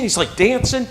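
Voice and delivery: ordinary delivery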